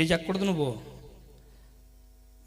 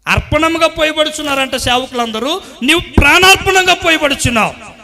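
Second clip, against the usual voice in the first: loud, higher preacher's cry; preaching at full force; 8 semitones higher, 15 dB louder than usual. Acted. Preacher thundering to the congregation, full force. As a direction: drive each line home to the church.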